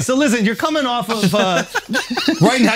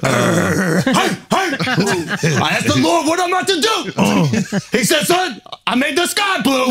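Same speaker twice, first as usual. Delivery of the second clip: higher mock emo rap voice; bad acting; 7 semitones higher than usual. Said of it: "deliver it like you back in the day".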